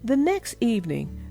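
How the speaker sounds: usual speaking voice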